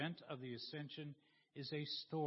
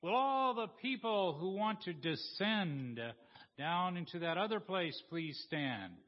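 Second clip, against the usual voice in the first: preaching minister; 3 semitones higher, 6 dB louder than usual. Acted. Minister puts the next question to the congregation, calling out loudly. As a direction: stretch out the question at raised volume. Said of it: "she asked the next question".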